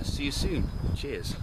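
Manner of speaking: normal talking voice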